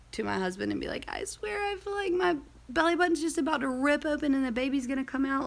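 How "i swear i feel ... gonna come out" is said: higher, pleading voice of remembered pain; retold crying complaint. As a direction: imitate yourself whining through tears. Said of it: "I would literally cry in bed".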